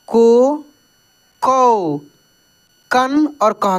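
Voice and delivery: slow, deliberate barakhadi recitation; each syllable said alone with a pause; syllable drill. Shown as a demonstration. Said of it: "उच्चारण होता है दोस्तों". (धीरे-धीरे अलग-अलग उच्चारण)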